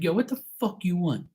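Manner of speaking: mock-irritated answer to a brother's interruption; retold irritated demand. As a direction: demand what he wants, pitch lifted. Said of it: "I said it just like that".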